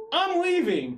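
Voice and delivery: high loud yelp; panicked shout. Retold or imitated. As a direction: yell it and bolt. He bolts from angry woman.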